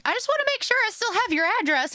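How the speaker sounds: high, rushed excited quote; animated. Retold or imitated. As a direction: imitate an animated messenger, racing along. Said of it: "Richard's messaging me animated".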